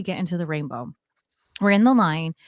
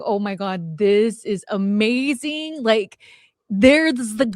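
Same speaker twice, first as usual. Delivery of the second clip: drawn-out, savoured amazement; retold awe; 3 semitones higher, slower than usual. Telling Chad how the song at the club moved her, in retold awe. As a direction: stretch it out in dreamy wonder. Retells being awed by a song.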